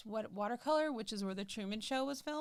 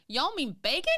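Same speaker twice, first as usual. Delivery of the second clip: higher, louder Southern employee's question; Southern accent; 6 semitones higher, 6 dB louder than usual. Retold or imitated. Correcting how the employee said it, loud Southern accent. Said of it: "I love that Southern accent".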